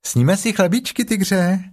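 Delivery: put-on high, childlike kangaroo voice; high-pitched youngster; little Roo. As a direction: pipe up like a little one. Little Roo speaks to Tigger.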